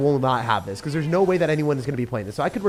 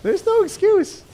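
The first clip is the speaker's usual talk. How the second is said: much higher, loud, drawn out; indignant outburst; 5 dB louder, slower than usual. Real, not acted. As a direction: cry out in disbelief. Ranting at the balance team.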